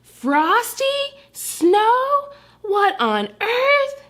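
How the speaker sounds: rising high, slow shocked mother; Mama finding the mess, high and stunned; Mama's shock. Acted. Mama discovering the wrecked couch, shocked and high. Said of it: "That's what she would say".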